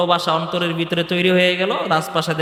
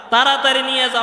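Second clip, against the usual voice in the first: higher-pitched, mildly acted urgency; urgent order; 7 semitones higher, 3 dB louder than usual. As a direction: press them to hurry, voice lifted.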